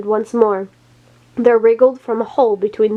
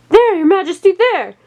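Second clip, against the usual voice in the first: high, louder pointing cry; frantic shout; 9 semitones higher, 3 dB louder than usual. A narrator's reading. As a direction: yell it to divert blame. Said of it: "he shouted".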